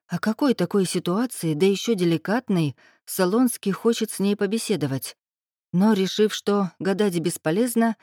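ordinary delivery

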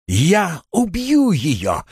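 high, drawn-out threat; furious shriek. A narrator's reading. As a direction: cry out in murderous rage. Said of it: "выкрикнула Эйлин".

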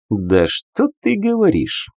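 high, drawn-out scoff; sarcastic snort. A narrator's reading. Narrator scoffing at the shadow's introduction, lightly snorting. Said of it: "фыркнул я".

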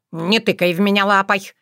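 ordinary delivery

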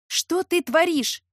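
high, loud, drawn-out question; startled protest. A narrator's reading. Heroine protests being pulled onto bed, startled.

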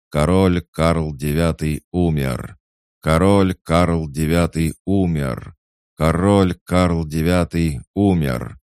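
loud, high ceremonial cry, building each time; proclaiming shout. A narrator's reading. Guard captain announces the king's death, shouted proclamation. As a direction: proclaim it to the whole courtyard. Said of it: "три раза крикнул".

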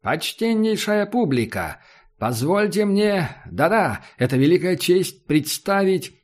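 booming, slow circus announcer; ringmaster announcer. Acted. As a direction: present it grandly to the crowd. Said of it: "его звучный поставленный голос".

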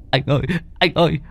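high, fast, panicked pleading; terrified wail. A narrator's reading. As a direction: cry out in shock over the bitten leg. Boy sobbing after losing leg. (gào lên hoảng loạn, giọng cao)

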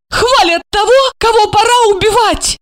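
high-pitched cry of despair; acted despairing woman, high voice. A narrator's reading. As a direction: conclude in despair, voice pushed high. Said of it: "в отчаянии заключила".

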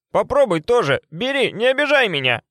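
high, pushy, through a mouthful; mouth full. A narrator's reading. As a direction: talk with your mouth stuffed. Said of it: "С набитым ртом проговорила Варвара".

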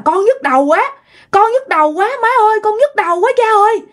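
high-pitched wail of pain; distressed crying out. Acted. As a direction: wail to your parents about your head. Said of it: "Mà ba la um sùm luôn". (kêu la đau đớn)

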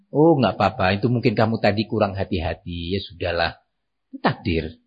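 low, unruffled parent voice; calm reassurance. Acted. Parent calmly comforting child after a mishap, low and accepting. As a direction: settle the child gently, pitch dropped. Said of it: "dengan tenang".

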